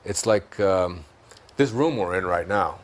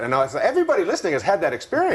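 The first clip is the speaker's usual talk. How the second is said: fast, higher, through laughter; laughing aside; 7 semitones higher, faster than usual. Real, not acted. Laughing as he tells listeners they know this. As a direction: rush it out while still laughing.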